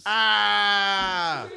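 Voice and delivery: loud drawn-out mock yell; loud hammed-up pain yell. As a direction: overdo a yelp of pain.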